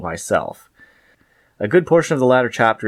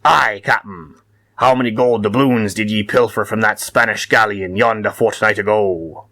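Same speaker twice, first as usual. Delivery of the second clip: hammed-up pirate captain voice; pirate talk; slower than usual. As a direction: play seafarers swapping words in thick pirate speak, building to a big rolling "arr". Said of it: "picture Koxinga talking with one of his admirals, and it might go something like this".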